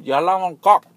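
belting it out so he finally hears; imitating a customer shouting it a third time; loud. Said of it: "she said it very loud the third time".

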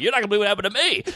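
eager rush. Retold or imitated. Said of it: "I'm like".

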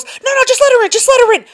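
high, loud hurried urging; hammed-up flustered attendant waving someone through; imitated gate attendant. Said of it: "and she goes".

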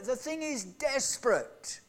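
usual speaking voice

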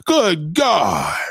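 usual speaking voice

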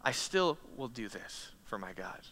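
quiet resolve. Acted. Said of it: "Abraham is determined".

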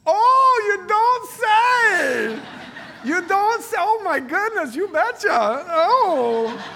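high, loud hammed-up Minnesota accent, slow and drawn out. Imitating mother-in-law winning at Catan, loud Minnesota accent. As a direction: mimic a gushing Minnesota mom in a Minnesota accent. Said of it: "in her Minnesota accent".

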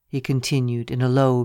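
usual speaking voice

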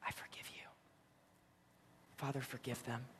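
very soft dying whisper; whispered prayer. Retold or imitated. Jesus prays for his tormentors, whispering. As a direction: breathe out a prayer with last breaths. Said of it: "His whispered prayer with his last breaths".

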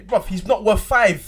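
loud disbelieving scoff. Real, not acted. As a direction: shout the scoff down. Heated football argument.

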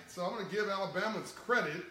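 usual speaking voice

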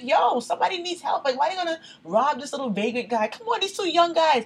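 high-pitched animated protest; re-enacted indignant plea. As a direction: argue urgently on a victim's behalf. Retold argument with husband.

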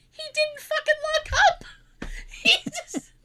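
high, loud, squeaking through laughter; laughing hard. Retold or imitated. Telling sister Dad left the door open, laughing hard. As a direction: burst it out while cracking up. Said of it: "I was laughing so hard".

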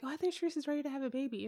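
soft, quick quote; low-volume retold remark; talking too low. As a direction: say it fast and under your voice. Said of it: "am I talking too low?".

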